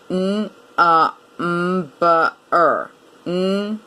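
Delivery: NUMBER sounded out, n, uh, m, buh, er; "N-A-M-B-E-R" as separate sounds, not letter names. Shown as a demonstration. Teacher counting five sounds, NUMBER sound by sound. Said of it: "Five sounds".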